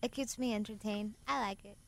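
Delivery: flat, lukewarm approval; unenthusiastic. Real, not acted. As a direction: sound barely interested. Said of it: "Not very enthusiastic".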